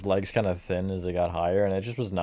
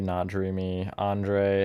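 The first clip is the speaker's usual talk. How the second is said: ordinary delivery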